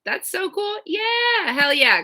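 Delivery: high, loud hell yeah; pumped-up yes; excited. Real, not acted. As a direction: cheer it. Excited over wheels.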